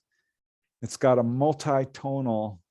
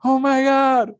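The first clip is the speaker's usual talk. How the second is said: high, loud panicked cry; high loud panicky quote of a scared friend; frightened friend; 12 semitones higher, 7 dB louder, slower than usual. Retold or imitated. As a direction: mimic her terrified outburst. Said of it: "she's like".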